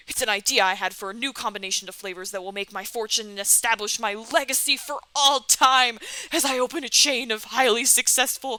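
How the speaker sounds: loud theatrical boast building in volume; grandiose Colonel. Acted.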